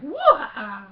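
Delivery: loud, high "wah" cry; imitated startled yelp. Retelling a stormtrooper knocked on the leg, mock yell. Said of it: "He's like".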